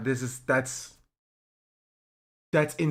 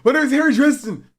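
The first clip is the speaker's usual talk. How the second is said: higher, a lightly voiced grand self-introduction; imitating him doubling down, half laughing; mock deluded intruder; 11 semitones higher, 4 dB louder than usual.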